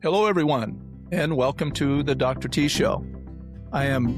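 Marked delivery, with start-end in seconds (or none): none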